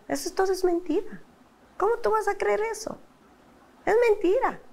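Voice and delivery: high-pitched retold protest; indignant disbelief. Wife telling husband the program is a lie, indignant and high. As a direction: reject it incredulously, voice jumping high.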